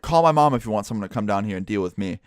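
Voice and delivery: usual speaking voice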